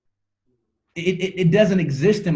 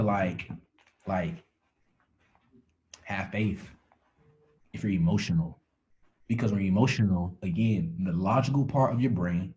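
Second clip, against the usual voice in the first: quiet; 9 semitones lower, 6 dB quieter than usual. Real, not acted. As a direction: drop your voice way down. Sudden drop into quiet.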